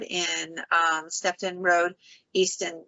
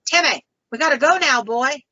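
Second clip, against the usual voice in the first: higher, louder Southern call; Southern accent; 6 semitones higher, 5 dB louder than usual. Retold or imitated. Older man screams for Timmy to leave, Southern accent. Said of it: "He's got that deep Southern accent".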